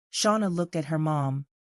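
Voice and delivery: ordinary delivery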